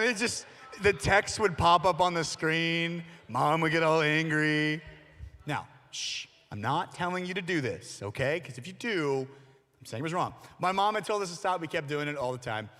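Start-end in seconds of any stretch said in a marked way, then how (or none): none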